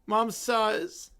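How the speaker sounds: usual speaking voice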